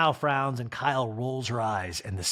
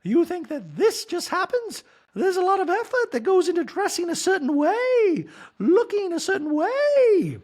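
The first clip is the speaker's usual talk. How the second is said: preen and lecture, pitched way up; mock shrill Dorit; 5 dB louder than usual. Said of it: "we see Dorit go".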